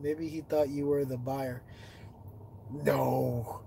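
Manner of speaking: ordinary talk, nothing marked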